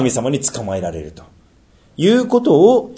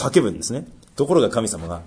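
usual speaking voice

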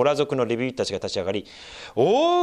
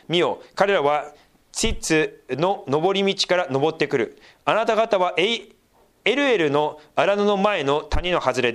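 raised, declaiming scripture voice; solemn Bible reading, pitched up; 5 semitones higher than usual. Acted. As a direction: proclaim the prophecy to the congregation. Reads the prophet's words aloud.

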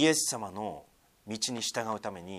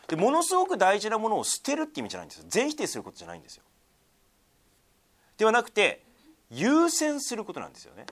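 raised, ringing sermon voice, pressing each point home; forceful preaching; 9 semitones higher, 7 dB louder than usual. Acted. Pastor urging his congregation to put Jesus first, forceful preaching.

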